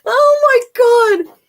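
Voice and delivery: high, loud, drawn-out gasp of delight; slow, high and loud disbelief; amazed. Real, not acted. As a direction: stretch it out, truly floored. Reacting to the Fred Savage story.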